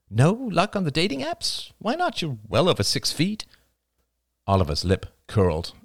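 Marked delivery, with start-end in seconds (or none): 0.1-3.5 higher, lightly voiced young woman; curious young woman, teasing. A narrator's reading. Narrator voices Rachel.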